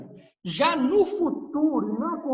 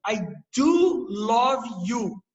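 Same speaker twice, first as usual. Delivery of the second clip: slow, louder "I do love you" with "do" stressed; emphatic "do"; 4 dB louder, much slower than usual. Shown as a demonstration. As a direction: press the word "do". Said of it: "Eu dei ênfase".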